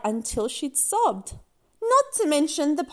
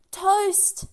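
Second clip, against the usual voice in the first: magic command; 8 semitones higher, 4 dB louder than usual. Acted.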